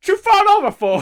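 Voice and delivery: very high and loud comic Stan; put-on Stan voice. Stan asks Harry why he fell, very high and loud. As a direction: squeeze it up high like a cheeky conductor. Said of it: "What accent is that?".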